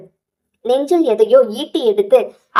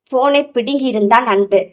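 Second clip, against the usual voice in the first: ordinary delivery, nothing marked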